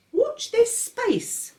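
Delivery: put-on very high, very slow baby voice; baby George teasing. A narrator's reading. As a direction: drag it out slyly like a baby. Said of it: "he said".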